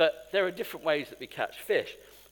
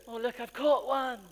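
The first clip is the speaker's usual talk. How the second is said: mock proud angler; 10 semitones higher, 4 dB louder than usual. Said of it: "And you go".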